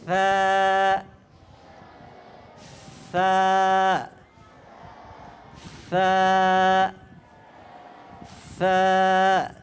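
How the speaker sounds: ফা said soft, light and drawn out, low; soft Arabic letter. Shown as a demonstration. Teacher models the letter for children, soft and slow. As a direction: model ফা softly for the class. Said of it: "একদম নরম করে উচ্চারণ করো".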